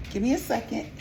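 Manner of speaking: ordinary talk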